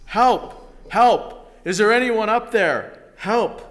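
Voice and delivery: loud, high desperate cries for help; stranded man yelling up a canyon wall. Acted. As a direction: shout up the cliff as if your life hangs on it. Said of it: "So Jack began yelling".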